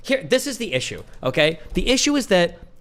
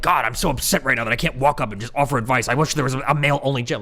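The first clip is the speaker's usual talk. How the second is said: mock whiny gym guy. Mocking guys wanting male-only gym.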